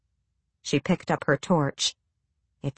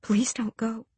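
unvoiced, soft pleading whisper; 5 semitones higher, 6 dB quieter than usual. A narrator's reading. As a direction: breathe it, pleading. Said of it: "she whispered".

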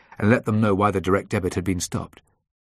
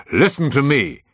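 loud, raised, stern demand; angry command; 7 semitones higher, 7 dB louder than usual. A narrator's reading. Boss losing patience with employee on the phone, angry.

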